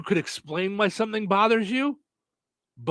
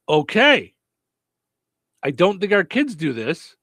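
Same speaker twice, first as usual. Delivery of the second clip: ordinary talk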